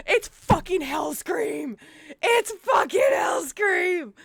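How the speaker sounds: slow, rising to a high loud shout; building excitement. Real, not acted. Streamer announcing her idol to chat, building shout. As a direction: say it, then say it again bigger.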